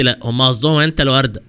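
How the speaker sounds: usual speaking voice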